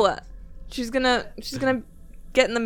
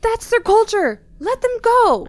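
loud, higher, slowed insistence; scolding shout; 11 semitones higher, 7 dB louder, slower than usual. Acted. Kin shouting at Levi to release the prisoner. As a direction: shout him down to let go.

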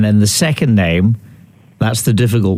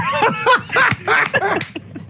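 high laugh; 5 dB quieter than usual. Real, not acted. Caller laughs at reveal.

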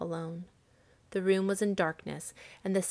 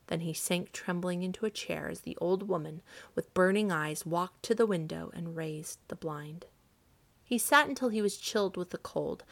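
ordinary delivery

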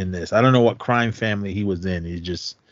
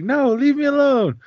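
much higher, squeaky put-on girl voice; mock girlfriend; 4 dB louder than usual. Girlfriend refusing the pushy boyfriend, squeaky mock whine. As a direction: whine it like a brushed-off date. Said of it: "They're like".

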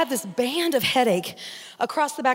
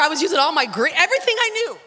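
ordinary talk

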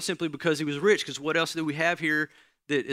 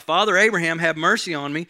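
loud pleading cry to Abraham; calling out; 3 semitones higher, 7 dB louder than usual. Acted. Rich man calling out from torment to Father Abraham, loud and pleading. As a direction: call up from far below, begging loud. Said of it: "And he called out".

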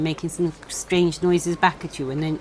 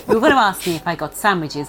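usual speaking voice

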